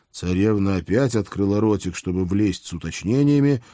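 normal talking voice

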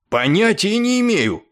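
high, loud exasperated answer; loud, worked-up retort; heated reply. A narrator's reading. Heated reply in the acted quarrel.

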